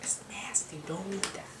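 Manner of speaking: hushed, disgusted background whisper; a snide aside muttered under the breath; gossipy whisper. Retold or imitated. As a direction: whisper it behind his back like nosy relatives. Relatives mocking food in background.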